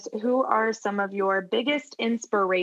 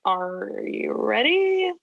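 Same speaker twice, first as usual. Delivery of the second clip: slow, drawn-out pirate call; pirate rallying the crew, slowly; much slower than usual. Retold or imitated. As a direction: stretch it like a pirate captain. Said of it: "I kinda sounded like a pirate".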